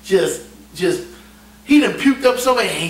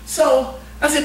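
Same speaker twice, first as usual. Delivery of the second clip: normal talking voice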